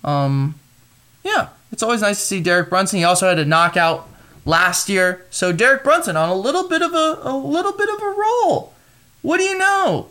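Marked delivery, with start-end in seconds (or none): 5.5-10.1 sing-song. Real, not acted. Sing-song about Brunson.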